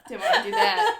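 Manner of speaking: loud, high, laughing words. Real, not acted. Host disowning the Cockney bit, laughing. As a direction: squeal it out through laughter.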